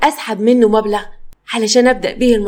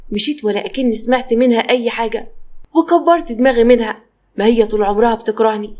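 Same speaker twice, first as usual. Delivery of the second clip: ordinary delivery, nothing marked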